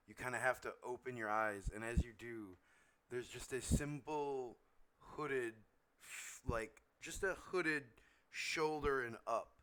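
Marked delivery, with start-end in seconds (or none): none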